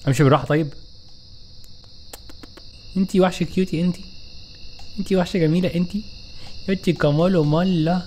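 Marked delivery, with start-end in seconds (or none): 3.0-8.0 cooing. Pretend. Cooing to the cute monster.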